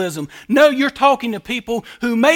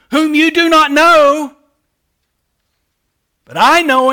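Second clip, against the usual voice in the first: cried out; 4 semitones higher, 5 dB louder than usual. Acted. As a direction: call it out to the whole temple. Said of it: "It says he cried out".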